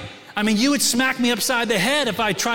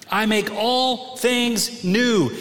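slow emphatic reading; much slower than usual. Acted. Preacher slowly re-reads the promise.

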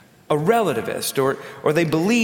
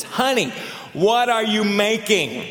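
higher call, drawn out slowly; calling out again; 6 semitones higher than usual. Retold or imitated. Husband asks his wife again from ten feet, slow raised call. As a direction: ask again from closer, slower and higher. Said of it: "and he says".

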